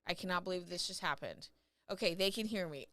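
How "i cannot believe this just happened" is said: ordinary delivery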